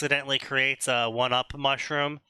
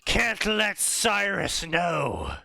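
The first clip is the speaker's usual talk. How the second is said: high, slow drawn-out spell; scheming wizard; 5 semitones higher, slower than usual. Retold or imitated. Wizard hiding the truth from Cyrus, slow and high. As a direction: stretch it slow, then cast high. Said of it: "the wizard is like".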